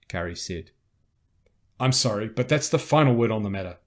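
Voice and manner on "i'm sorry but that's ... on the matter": loud, authoritative refusal; commanding officer, voice raised; firm final word. Acted. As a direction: shut the argument down firmly. Commander closes the argument.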